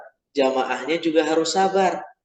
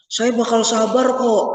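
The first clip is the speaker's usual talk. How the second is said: eager Musa pleading; 5 semitones higher than usual. Retold or imitated. Musa insists in story.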